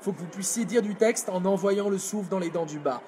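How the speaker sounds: ordinary talk, nothing marked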